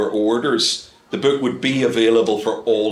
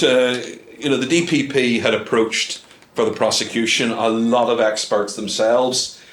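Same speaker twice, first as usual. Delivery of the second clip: ordinary talk, nothing marked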